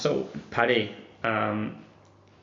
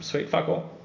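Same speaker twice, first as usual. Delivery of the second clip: put-on Australian accent; 7 semitones higher than usual. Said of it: "As the Australians say".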